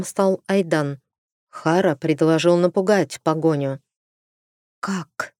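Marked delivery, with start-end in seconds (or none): 4.8-5.3 soft, hushed question; whispered. Acted. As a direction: drop to a whisper. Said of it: "Таким же шёпотом бросила".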